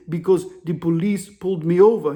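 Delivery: put-on measured African-accented answer; imitated African caller. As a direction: African accent, every word set apart. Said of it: "The African dude's whispering".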